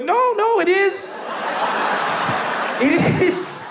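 high, insistent denial; mock-reassuring friend. Best friend misleading his classmate, high mock insistence. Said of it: "He's like".

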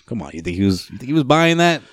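usual speaking voice

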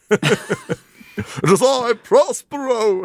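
high, slow, grand stage declaiming; hammed-up theatrical Prospero, drawn out; hammy Shakespeare actor. Joking about Stratford, hammed-up stage actor.

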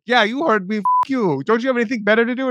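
sneering scammer voice, rushed at the end; mock scammer rant. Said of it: "They're like".

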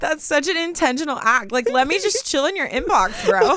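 high-pitched, laughing protest; amused, exaggerated grievance; mock indignation. Mock complaint about being unmatched.

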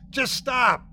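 high-pitched pleading yell. A narrator's reading. Warren alone, yelling at the basement noise to stop. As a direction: yell it out high into an empty house. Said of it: "yelling at the air".

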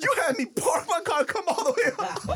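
high whiny grown-up complaint; mock whiny complaint. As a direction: whine it half laughing. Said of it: "I'm like".

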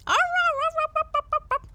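very high, loud yelping howls, fading; dog howling. Acted. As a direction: howl like a frightened dog. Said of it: "howled the dog".

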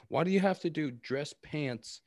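ordinary delivery

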